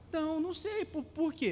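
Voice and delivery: high, puzzled mock woman's voice; surprised woman voice. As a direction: play the startled churchgoer caught off guard. Woman voiced in retold dialogue.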